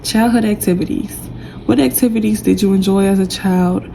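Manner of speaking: ordinary delivery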